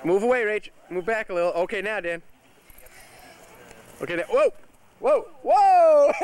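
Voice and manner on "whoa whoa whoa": loud, sudden cry of alarm; real startled shout; startled whoa. Real, not acted. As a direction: yelp as the tire swings into her. Tire hits child, father exclaims.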